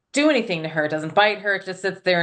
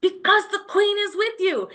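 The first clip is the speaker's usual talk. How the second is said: excited realization; 11 semitones higher than usual. Retold or imitated. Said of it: "And I'm like".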